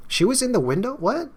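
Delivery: squeaky high astonished what; startled disbelief. Real, not acted. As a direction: jolt up in real surprise. Player startled by reveal.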